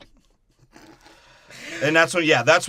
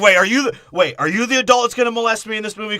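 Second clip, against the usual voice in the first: high, loud, rapid questioning; incredulous boy; 10 semitones higher, 8 dB louder, faster than usual. Acted. Boy challenging the adult stranger, high rapid voice. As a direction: rattle it off as a mock-alarmed kid.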